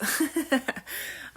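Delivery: short unvoiced laugh. Real, not acted. Woman apologizing to her sister on camera, laughing.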